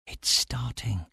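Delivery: soft, slow, hushed warning; whispered. A narrator's reading. Stellos warns the narrator at the window, whispering. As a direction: barely voice it, slowly. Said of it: "he whispered".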